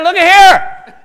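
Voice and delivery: slow, loud, high laughing shout; triumphant shout. Acted. David showing the crowd Goliath's head, triumphant.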